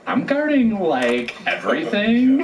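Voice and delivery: put-on high Valley girl lilt on guarding and everything; airy Valley accent, rising pitch. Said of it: "your little Valley accent".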